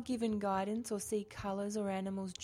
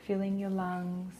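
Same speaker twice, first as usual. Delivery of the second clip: soothing massage murmur. Acted. Leads a guided meditation.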